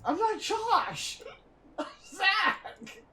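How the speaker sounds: high, slow, laughing protest. Real, not acted. Correcting a teacher who calls him Josh, high laughing protest. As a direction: laugh it off in disbelief.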